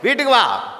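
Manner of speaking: mother's order home, suddenly high and sharp; loud mock command. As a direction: raise your voice to order him home. Mother orders son home, louder take.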